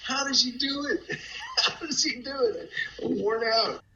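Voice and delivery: high-pitched marvel broken by laughs; laughing disbelief. Retold or imitated. Worn-out storyteller marveling at his friend, laughing. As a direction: laugh through the astonished question.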